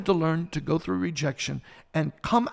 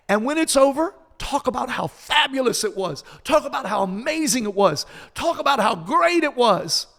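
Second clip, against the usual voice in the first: higher, louder, emphatic motherly urging; rallying pep talk in a raised pitch; encouraging mom; 6 semitones higher, 4 dB louder than usual. Retold or imitated. Mom coaching a devastated kid through the event, emphatic encouragement. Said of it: "finally my mom said".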